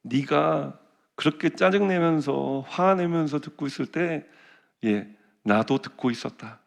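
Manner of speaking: slow, soft and low, God speaking kindly; slow tender divine voice; gentle voice of God. Retold or imitated. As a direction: quietly tell him you were listening too. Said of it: "하나님 말씀하시는 거예요".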